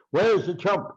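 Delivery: high, loud imitation of a ranting boxer; hysterical taunting. Imitating the boxer storming the weigh-in, hysterical taunts. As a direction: crow it up high, punching the words. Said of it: "a lot of the hysterical things he did".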